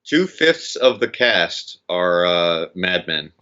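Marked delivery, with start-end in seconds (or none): none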